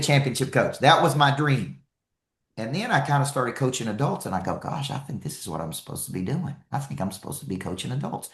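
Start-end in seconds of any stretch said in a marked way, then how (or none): 4.6-8.3 quiet musing. Retold or imitated. Inner realization, quoted to self.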